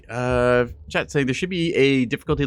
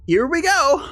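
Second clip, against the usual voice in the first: high, loud strangled yowl; Drown the Cat voice; 9 dB louder, slower than usual. Pretend. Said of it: "my chat has redeemed the Drown the Cat voice".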